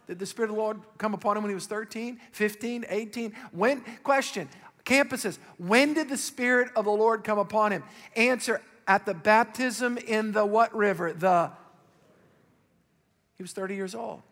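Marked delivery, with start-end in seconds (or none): none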